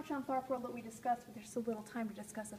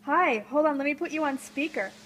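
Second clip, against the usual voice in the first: loud, lively call pickup; real loud excited hello; loud phone greeting; 11 dB louder than usual. Real, not acted. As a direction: project brightly to a caller. Answering live phone call.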